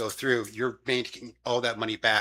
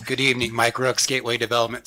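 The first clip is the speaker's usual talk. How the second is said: raspy voice pushed louder; hoarse voice, louder; 5 dB louder, faster than usual. Real, not acted. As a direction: push past the lost voice. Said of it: "Sorry, I have no voice".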